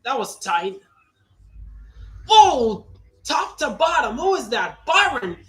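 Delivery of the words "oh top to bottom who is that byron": loud, high burst at the move; excited shout. Real, not acted. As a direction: yell it as the car dives down. Big move in race.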